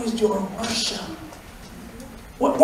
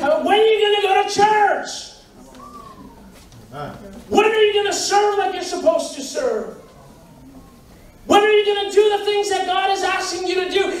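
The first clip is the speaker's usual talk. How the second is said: raised, fast and higher rebuke; challenging; 6 semitones higher than usual. Real, not acted. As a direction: call them out, pressing hard. Preacher challenging congregation.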